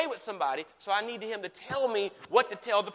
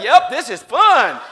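forced cheer; 4 semitones higher, 14 dB louder, slower than usual. Retold or imitated. Husband forcing cheer.

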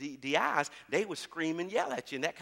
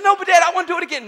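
put-on high, fast child's plea; little girl begging; 18 dB louder, faster than usual. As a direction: beg like an eager little daughter. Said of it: "and she's".

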